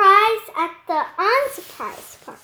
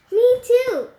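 put-on higher, louder girl voice; eager girl voice; 6 semitones higher, 6 dB louder than usual. Willa chimes in after Emerson, higher and louder. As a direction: pipe up high and loud. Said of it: "said Willa".

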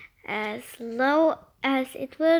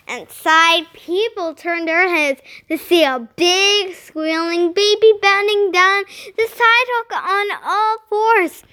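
ordinary delivery

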